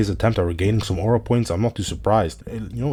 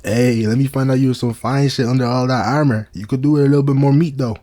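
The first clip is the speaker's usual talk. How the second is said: voicing the champ; 5 semitones higher, 5 dB louder than usual. Voicing the champ flirting with Sheta.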